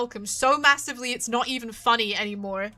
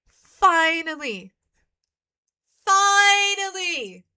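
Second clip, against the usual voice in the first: slow, rising, louder finally; slow building relief; drawn-out finally; 3 semitones higher, 3 dB louder, much slower than usual. Real, not acted. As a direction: stretch each finally out longer. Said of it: "waiting for this moment".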